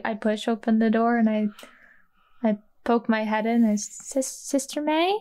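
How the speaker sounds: ordinary talk, nothing marked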